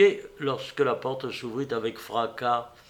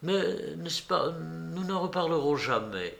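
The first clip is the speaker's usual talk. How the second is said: plus haut, lent et doux, douloureux; voix douloureuse; 4 semitones higher, 5 dB quieter than usual. A narrator's reading. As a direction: une peine soudaine qu'elle retient, lentement. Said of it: "d'une voix subitement douloureuse". (voix subitement douloureuse, plus haute)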